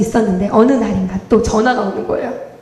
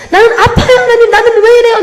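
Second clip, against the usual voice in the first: higher, louder anguished cry to God; 9 semitones higher, 7 dB louder than usual. Retold or imitated. Girl crying out to God on the church floor, loud. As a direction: shout up to heaven in pain. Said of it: "내가 소리 지르고 있었지만".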